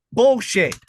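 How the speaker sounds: mock disbelief. Calling out his friend's weight claim, loud and high scoff. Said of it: "I'm like".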